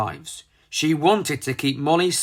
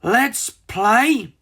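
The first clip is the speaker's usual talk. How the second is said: playful; 8 semitones higher, 4 dB louder than usual. Acted. Frizzle delighted at the park.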